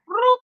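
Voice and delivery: high-pitched rising "broop"; imitated lever noise. Patient imitating the doctor's machine lever, high broop. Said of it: "the lever was just like".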